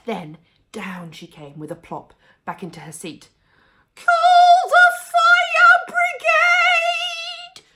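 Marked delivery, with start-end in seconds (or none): 3.9-7.6 very high, very loud slow wail; grandma screaming for the fire brigade; shout. A narrator's reading. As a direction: bellow it out in a panic. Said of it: "she shouted suddenly".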